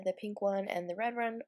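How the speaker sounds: ordinary talk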